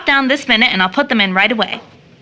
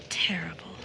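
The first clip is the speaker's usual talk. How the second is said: breathless whisper of terrible; whispered word; 4 semitones lower, 13 dB quieter than usual. Acted. Rachel whispers the word to herself in grief, a hushed whisper. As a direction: let "Terrible" out as a toneless whisper. Grieving woman repeats word.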